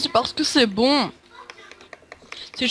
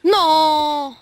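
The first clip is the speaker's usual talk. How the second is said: sharp loud cry of no; loud protest; 4 semitones higher, 9 dB louder than usual. Real, not acted. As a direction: cry out against it. Protesting friend's countdown.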